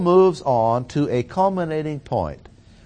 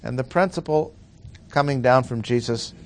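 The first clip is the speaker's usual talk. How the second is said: ordinary talk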